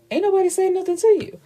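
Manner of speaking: very high, quick sassy comeback; sassy retort. Retold or imitated. Client brushing off her lawyer, sassy. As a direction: fire back fast in a high, sassy pitch.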